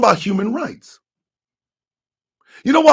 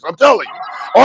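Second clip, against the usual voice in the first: usual speaking voice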